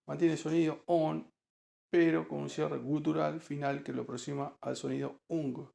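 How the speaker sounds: usual speaking voice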